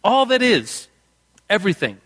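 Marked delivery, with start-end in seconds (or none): 0.0-0.9 All punched up, then falling away; stress on all. Shown as a demonstration. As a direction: lean hard into All. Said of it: "Of, everything".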